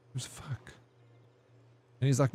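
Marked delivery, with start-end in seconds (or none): 0.1-0.7 sneered under the breath, no voice; whisper. Retold or imitated. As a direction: drop to a bare whisper for the insult. Whispered aside in retold quote.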